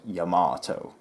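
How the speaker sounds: Yamato as 'yuh-MAAH-toe', slow, long middle vowel; correcting pronunciation. Retold or imitated. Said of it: "it's pronounced".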